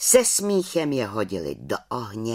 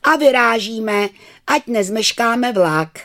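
teacher calling out; 10 semitones higher, 7 dB louder than usual. Acted. Teacher calls the class.

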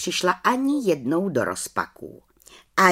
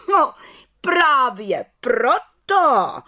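slow, loud, high scolding; 8 semitones higher, 4 dB louder, much slower than usual. Acted. Head witch scolds the little witch, slow and loud. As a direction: tell her off, drawn out. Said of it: "hubovala vrchní čarodějnice".

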